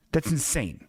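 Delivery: ordinary delivery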